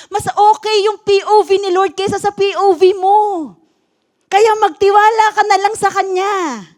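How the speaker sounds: impassioned pastor preaching; preaching at full force. Acted. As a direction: drive the message home to the church.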